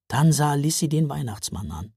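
plain reading voice

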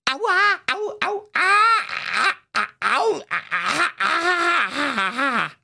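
very high yelps of pain; in pain at the dentist. Acted. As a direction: whimper through a drilled tooth. Patient in the dentist's chair.

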